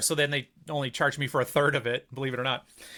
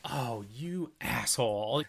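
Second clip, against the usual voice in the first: muttered insult; 3 semitones lower, 4 dB quieter, much slower than usual. Retold or imitated. Retelling reaction to dad.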